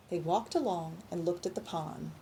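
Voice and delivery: plain reading voice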